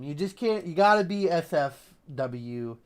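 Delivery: normal talking voice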